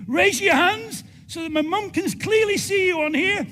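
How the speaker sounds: loud, high, rallying the crowd; calling out to crowd. Real, not acted. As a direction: shout it across the hall to everyone. Calling out to the audience.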